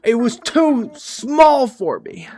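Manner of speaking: high, slow booming deity; hammy god voice. Pretend. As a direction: ham it up like a god.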